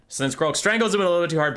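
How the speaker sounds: normal talking voice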